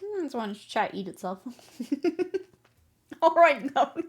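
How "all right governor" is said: loud, higher hint of British geezer; mock British accent. Streamer teasing chat about a faked accent, mock British. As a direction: put on a light jokey British accent.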